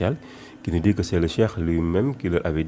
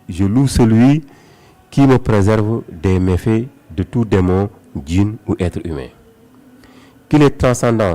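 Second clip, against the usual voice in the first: loud, raised declamatory verse reading; poem recitation; 3 semitones higher, 8 dB louder than usual. A narrator's reading. Guest reciting the Cheikh's praise poem for listeners, declaimed. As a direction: proclaim each line of devotion with force, pausing between phrases.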